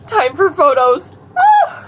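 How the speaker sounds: high, loud squeal into "Ah!"; excited shout. Real, not acted. Vlogger announcing the photos, excited squealing shout. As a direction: burst out with glee.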